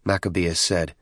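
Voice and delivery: plain reading voice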